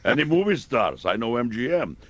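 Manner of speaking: a hint of a Russian cabbie asking for big names; Russian cabbie accent.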